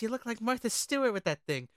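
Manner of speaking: usual speaking voice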